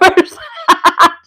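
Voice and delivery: high, loud burst of laughter; a real giggle breaking out. Real, not acted. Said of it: "I can't stop laughing now".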